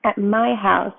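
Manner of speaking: plain reading voice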